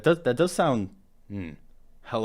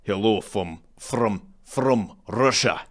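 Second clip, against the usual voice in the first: weak Russian accent try; slower than usual. Shown as a demonstration. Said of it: "I can't do any accents at all".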